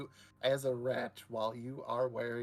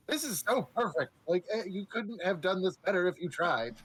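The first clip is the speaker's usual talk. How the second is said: delighted gushing; 6 semitones higher than usual. Acted. Voicing the NPC's delight.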